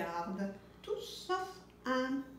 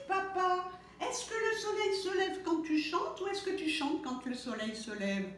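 curious chick; 7 semitones higher than usual. A narrator's reading. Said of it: "Il s'adresse à son père".

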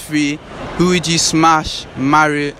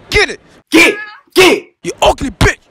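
shouting them off; 12 semitones higher, 5 dB louder than usual. Real, not acted. Shooing the girls away.

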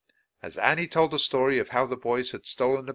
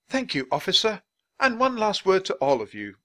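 high, projected public address; orating Dickens; 10 semitones higher, 5 dB louder than usual. Acted. Dickens turns from officer to crowd, orating. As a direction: lift the voice to the whole crowd.

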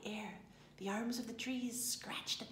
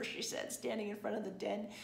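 ordinary delivery, nothing marked

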